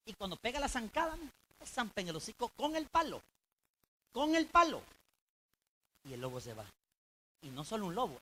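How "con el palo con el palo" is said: emphatic. Retold or imitated. Shepherd strikes the wolf.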